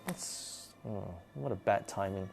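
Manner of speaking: low, quiet grumble to oneself; soft, deflated aside; muttered thought. Retold or imitated. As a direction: retell a private complaint under your breath. Retold private grumble to herself.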